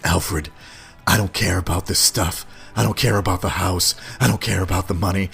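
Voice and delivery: low-pitched brooding hero impression. Bruce Wayne brushing off his butler, low impression. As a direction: drop low and dismiss it.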